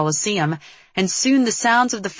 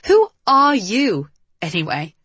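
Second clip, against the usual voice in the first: biting; 4 semitones higher, 5 dB louder than usual. A narrator's reading. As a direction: lean hard and scornfully on the opening. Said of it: "Kat's voice turned from sensible to biting".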